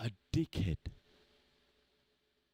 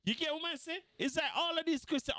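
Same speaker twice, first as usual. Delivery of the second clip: ordinary talk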